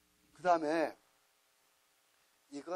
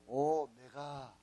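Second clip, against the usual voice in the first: 오, 메가 split in two, each syllable slow and loud; split syllables, drawn out; 8 dB louder, much slower than usual. Shown as a demonstration. Teacher spelling out the name for the class, slow and loud. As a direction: pull 오 apart from 메가, slowly and loudly. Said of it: "큰 O라 해가지고서".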